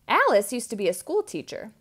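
usual speaking voice

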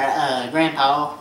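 normal talking voice

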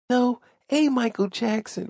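high, light [name] impression, pitched well up; coy [name] denial. Telemarketer stays in character for the caller in [name]'s voice. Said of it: "I'm trying to do the [name] voice".

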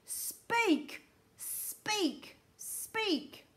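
high, drawn-out repeated commands; frightening shout. A narrator's reading. Houston's voice rattles Charlie's eardrums, frightening high shout. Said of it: "ending in a fearful, frightening shout".

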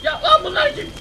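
high startled cry; sudden fright at strangers; shocked. Real, not acted.

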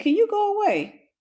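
high fed-up plea; mock annoyed go away; exasperated. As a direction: push him off, way up high. Telling husband go away.

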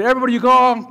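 mock announcement; calling out. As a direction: announce it to the crowd. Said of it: "he tells everybody".

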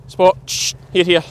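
calling the dog. Real, not acted. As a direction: call the dog in, quick and firm.

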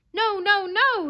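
very high, loud yelps; yelped denial. A narrator's reading. Dog denying the fox's charge, yelping. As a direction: cry out like a stung dog. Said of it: "yelped the dog".

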